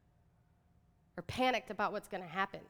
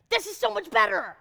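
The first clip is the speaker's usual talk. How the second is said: thrilled; 10 semitones higher, 5 dB louder than usual. Real, not acted. Thrilled about billboard.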